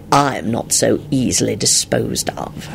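slow, calm, composed reply; unhurried, quiet composure. A narrator's reading. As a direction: answer without being ruffled. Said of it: "he replied calmly".

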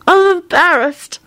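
high voice smothered by a pillow; slow, muffled embarrassed whine. A narrator's reading. Elizabeth hiding beneath a pillow, muffled. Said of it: "her muffled voice replied from beneath the pillow".

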